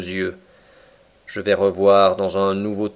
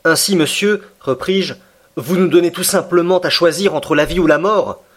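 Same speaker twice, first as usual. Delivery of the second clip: higher, louder accusing question; indignant challenge; 8 semitones higher, 4 dB louder than usual. Acted. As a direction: throw the ultimatum back at him. Prisoner confronts captain's ultimatum. (indigné, il défie le commandant)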